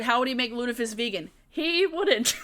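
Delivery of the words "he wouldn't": high, laughing realization. Real, not acted. Streamer concedes chat's vegan point, laughing. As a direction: break into laughter on the words. Cracks up answering chat.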